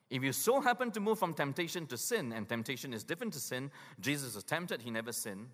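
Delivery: ordinary talk, nothing marked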